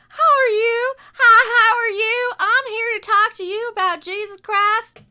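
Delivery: high, chirpy mock visitor greeting; put-on, loud high-pitched caller; door-to-door preacher. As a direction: pitch it up like an eager doorstep evangelist. Acting out a door-knocking preacher.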